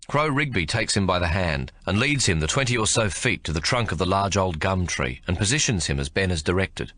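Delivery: plain reading voice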